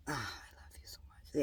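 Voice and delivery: barely audible whispered endearment; intimate whisper. Real, not acted. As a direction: whisper it privately to your beloved. Private aside to her divine husband.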